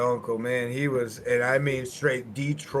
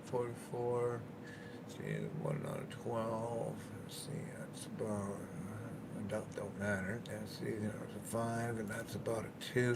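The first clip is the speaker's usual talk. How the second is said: muttering; 3 semitones lower, 13 dB quieter than usual. Real, not acted. Muttering calculations to himself.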